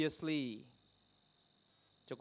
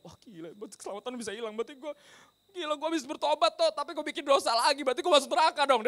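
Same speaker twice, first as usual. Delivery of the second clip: imitated panic; 12 semitones higher, 7 dB louder than usual. Preacher imitates believer afraid of losing salvation, panicking. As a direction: act out someone frantically panicking. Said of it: "dia panik karena dia bilang kayak".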